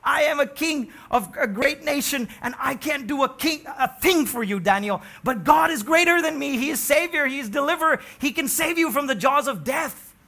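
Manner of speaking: loud, high fervent declaration; loud impassioned confession; fervent king's confession. Acted. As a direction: proclaim it as the king. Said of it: "He said".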